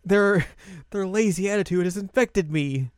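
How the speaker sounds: high-pitched, hammed-up horror; mock dismay. Azusa realizes she has changed, mock dismay. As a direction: lament it in a high voice.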